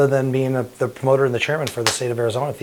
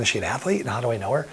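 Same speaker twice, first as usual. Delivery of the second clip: inner thought; 9 dB quieter, faster than usual. Retold or imitated. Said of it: "I'm thinking".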